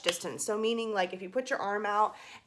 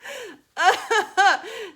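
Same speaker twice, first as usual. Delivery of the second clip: high burst of laughter; 11 semitones higher, 8 dB louder than usual. Real, not acted. Laughing at her own bar joke.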